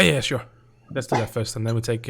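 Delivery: ordinary talk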